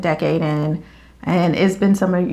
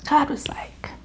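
whispered; 7 semitones higher, 6 dB quieter than usual. Real, not acted. Whispered lead-in to God.

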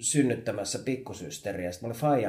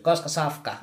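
higher-pitched mock holler up to a window; imitated child's call; 6 semitones higher, 4 dB louder than usual. Child in the yard asking mum about dinner, mock calling out. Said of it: "sit mä huusin jossain vaiheessa".

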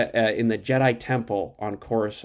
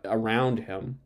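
ordinary delivery

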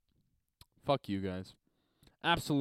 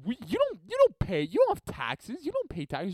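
incredulous retort; 5 semitones higher than usual. Retold or imitated. Retelling mocking a classmate in class, high incredulous scoff. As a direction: laugh it off in disbelief. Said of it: "I laugh and I'm like".

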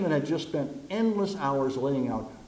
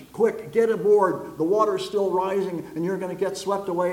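louder and higher, slowed urgent warning; urgent shout; 5 semitones higher, 5 dB louder than usual. Acted. Boat crew shouts to stranded man, urgent and loud. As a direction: call out to him over the rising water. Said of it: "the people shout".

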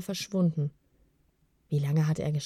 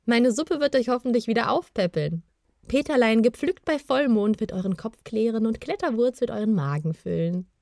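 higher, lively female-character voice; amused, cheerful cook; 4 semitones higher, 4 dB louder than usual. A narrator's reading. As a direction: present your soup with a smirk. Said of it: "Gemalda schmunzelte".

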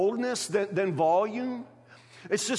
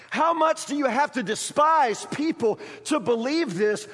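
raised, driving pulpit voice, question after question; fiery preacher calling the church to believe; preaching at full force; 5 semitones higher than usual. Acted. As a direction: hammer each question home to the congregation.

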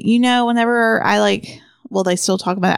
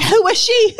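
mock surprise; 12 semitones higher than usual. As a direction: ask it in mock disbelief. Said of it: "I'm like".

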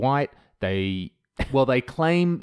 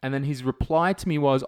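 ordinary talk

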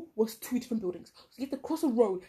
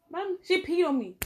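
slow, higher, louder complaint; 8 semitones higher, 5 dB louder, slower than usual. Retold or imitated. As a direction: announce it to the whole hall. Classmate complaining loudly.

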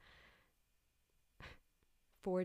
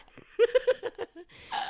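high, shrieking cackle; loud laugh; 16 dB louder than usual. Real, not acted. Cracking up with friend at his reaction, loud laughter.

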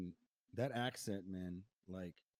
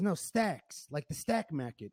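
put-on louder, insistent New Jersey accent; "stacks" for stocks, mock New Jersey A; obnoxious New Jersey accent; 4 semitones higher, 6 dB louder, slower than usual. Greek girl correcting a stranger at a bar, New Jersey accent. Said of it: "obnoxious New Jersey accent".

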